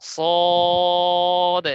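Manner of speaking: সদ loud with a long, round, level "o"; heavy, drawn-out. Shown as a demonstration. Teacher showing how it must be said, long heavy সদ. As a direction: hold সদ thick, mouth open top to bottom. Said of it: "এভাবে বলতে হবে".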